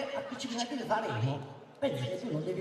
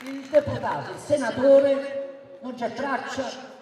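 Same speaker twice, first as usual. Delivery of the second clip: booming balcony speech; 5 semitones higher, 8 dB louder than usual. Real, not acted. Listing opponent's offices, shouting.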